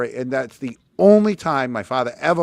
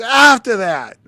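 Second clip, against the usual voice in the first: a hint of a stern father, higher and louder; angry father; 10 semitones higher, 10 dB louder, slower than usual. Father presses his son against the wall, mock stern demand. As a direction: bark the demand.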